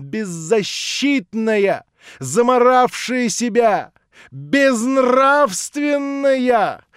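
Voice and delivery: slow bitter irony. Acted. Natasha mocking the prince's view of her, slow bitter irony. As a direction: drag out every insult.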